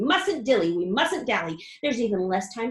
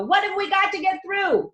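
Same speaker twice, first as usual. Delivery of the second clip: high, loud question; alarmed shout; 8 semitones higher, 6 dB louder than usual. A narrator's reading. Grandpa Joe shouting at Wonka, high and loud. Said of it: "shouted Grandpa Joe".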